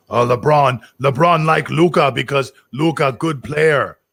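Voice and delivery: slow, heavy fake Russian accent in broken English; lumbering mock Russian-accented LeBron. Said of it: "He's got, like, a Russian accent".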